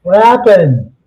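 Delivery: loud, high mock outrage; exasperated shout. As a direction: yell it like you got cheated. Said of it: "I'm like".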